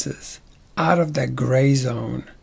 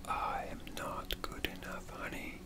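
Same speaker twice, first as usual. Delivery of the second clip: playful whisper; 22 dB quieter than usual. Shown as a demonstration. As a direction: whisper it intimately to a sweetheart. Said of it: "what other ways you could say".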